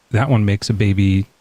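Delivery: usual speaking voice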